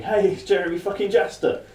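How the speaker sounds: high-pitched mock shout; retold shout. Fan spotting [name] at the festival, retold high shout. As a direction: call it out excitedly, without full volume. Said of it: "rather loudly shouting".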